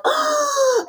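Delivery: high sharp gasp of delight; breathless excited gasp; thrilled gasp. Retold or imitated. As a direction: gasp like the film just blew you away. Reacting to great film.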